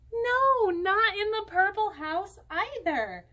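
high, loud playful no; hammed-up surprise for the kids; mock surprise. Mouse not found again.

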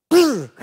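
bee tickle noise. Retold or imitated. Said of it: "And he goes".

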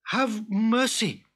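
mild high, slow plea; a touch of desperate begging; crying for mercy. Acted. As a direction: cry out lightly for mercy. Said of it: "cried Abdullah".

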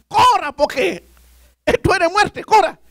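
grito retold urgente y agudo; grito de advertencia. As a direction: imita al compañero gritando que huya, muy agudo. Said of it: "cuando él me grita". (grito de alarma imitado)

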